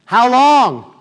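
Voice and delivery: high, loud wail of "how long"; hammed-up cry to the heavens; mock anguished cry. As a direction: cry out in exaggerated dental-chair agony. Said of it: "I cried out".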